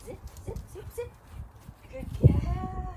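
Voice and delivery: high-pitched cue and praise; squeaky praising a dog. Real, not acted.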